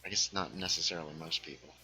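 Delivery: usual speaking voice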